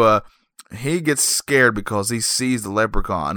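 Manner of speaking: ordinary talk, nothing marked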